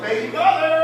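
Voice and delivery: high, loud call; storybook sister yelling down the hall. Acted. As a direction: holler for your little brother. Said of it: "So she yells".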